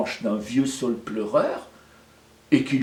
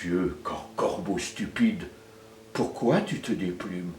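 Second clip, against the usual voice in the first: put-on old willow voice; 7 semitones lower, 7 dB quieter than usual. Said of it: "Le vieux saule pleureur lui demande".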